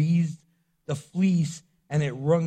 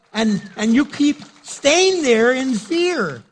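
slow, louder, higher rebuke; preaching at full force; 8 semitones higher, 7 dB louder, slower than usual. Real, not acted. Preacher calling out the fearful, slow and loud. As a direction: drive it home to the congregation.

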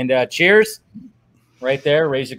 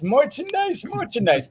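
high sing-song "merchandise"; 11 semitones higher, 4 dB quieter than usual. Pretend. Repeated word, sung-out take.

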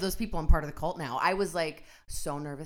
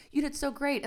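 gushing praise; 9 semitones higher than usual. Retold or imitated. Retells classmate praising her.

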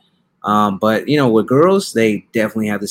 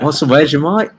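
mock Australian accent; 4 semitones higher than usual.

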